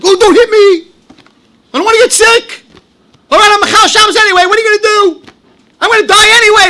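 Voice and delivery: high, loud mock panic of frightened believers; fearful generation. Mocking people who fear an angry God, high hammed-up panic. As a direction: whine it like people cowering from a punishing God. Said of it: "they're all walking around like".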